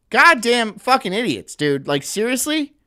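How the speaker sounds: normal talking voice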